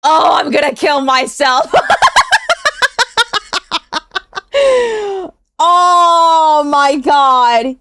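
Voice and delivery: laughing so hard it's almost crying; laughing in disbelief. Real, not acted. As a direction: shout it through a burst of laughter. Reacting to shocking clip.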